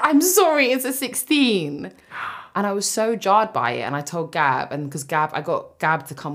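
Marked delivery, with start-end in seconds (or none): 0.0-1.9 much higher and louder, mocking the fitter's line. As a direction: squeal his words back at him in disbelief. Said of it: "What do you mean".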